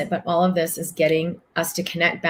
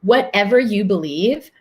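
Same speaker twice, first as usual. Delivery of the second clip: usual speaking voice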